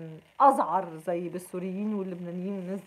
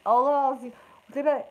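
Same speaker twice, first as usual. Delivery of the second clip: usual speaking voice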